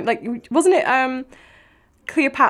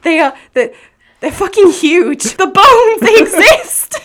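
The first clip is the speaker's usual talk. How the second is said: exasperated shout; 3 semitones higher, 7 dB louder than usual. Retold or imitated. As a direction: yell it in disbelief. Mocking exasperated rant.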